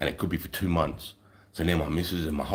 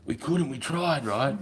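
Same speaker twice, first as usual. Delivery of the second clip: mate's voice; 8 semitones higher, slower than usual. Retold or imitated. Mate answering in retold story.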